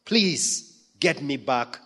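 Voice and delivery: high, pleading prayer voice; lifted, imploring prayer; earnest prayer. Acted. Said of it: "we need to pray".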